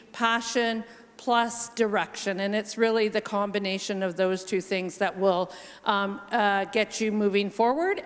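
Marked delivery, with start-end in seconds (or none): none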